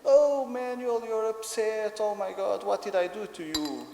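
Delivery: high, loud exaggerated sympathy; concerned daddy. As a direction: fuss over an upset small child. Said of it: "I usually go there and say".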